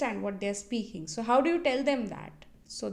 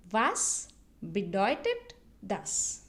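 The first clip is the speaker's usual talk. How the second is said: slow German with gaps between "Was", "bedeutet", "das"; slow model phrase; 2 semitones lower, slower than usual. Shown as a demonstration. Teacher models a question for beginners, slowly and separated. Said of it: "repeat it twice so that you can listen".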